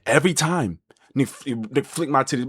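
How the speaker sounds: ordinary talk, nothing marked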